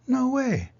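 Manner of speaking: put-on high, loud no-way of eager kids; kids refusing. Room one oh nine refusing to trade Jerry, high excited cry. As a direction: squeal it like a class guarding its pet.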